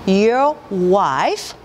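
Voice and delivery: wife said as one syllable, not 와이프; your wife linked, slow. Shown as a demonstration. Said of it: "와이프 세 개로 하시는 것이 아니라 wife".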